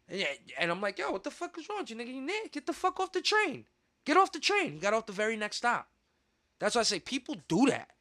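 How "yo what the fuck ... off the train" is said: high, heated telling-off, fast at first; angry yelling. Retold or imitated. Narrator confronting the man filming on the train, high angry yell. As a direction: retell chasing him off the train.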